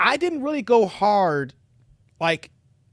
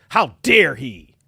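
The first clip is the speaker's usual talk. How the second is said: gruff bark; 5 semitones lower, 5 dB louder, much slower than usual. Acted. Gruff growl at Kevin.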